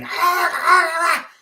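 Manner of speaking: high, loud yappy barks with a laugh; yapping little dog. Retold or imitated. Imitating the mini dog barking at the door. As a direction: bark like a tiny angry dog.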